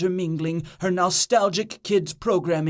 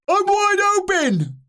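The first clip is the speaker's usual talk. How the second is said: high, drawn-out holler; long high scream across a sports field; teammate's scream; 11 semitones higher than usual. A narrator's reading. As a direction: stretch it out high, calling for the pass. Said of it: "screamed Cuddlebutt".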